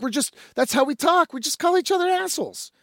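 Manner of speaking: quick, high retold reassurance; girlfriend smoothing over. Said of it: "his girlfriend's like".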